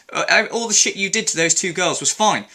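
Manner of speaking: normal talking voice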